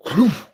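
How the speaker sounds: high loud popping mouth noise; popping cloop sound. Retold or imitated. Showing the hot dogs going down, loud popping cloop. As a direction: pop it out like food shooting down a throat. Mimicking food blown down throat.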